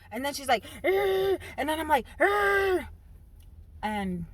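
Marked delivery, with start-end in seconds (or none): 0.8-1.4 short high growling yell; mock fight growl. 2.2-2.9 short high growling yell; mock fight growl.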